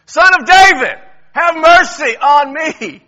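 very loud, high, slow desperate shout. Acted. Blind beggar shouting over those silencing him. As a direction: bellow it out over the crowd. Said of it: "he shouted all the more".

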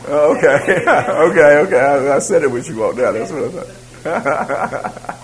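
okay through real laughter, loud at first; amused, breaking into laughter; laughing. Real, not acted. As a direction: laugh while conceding the point. Amused exchange with the class.

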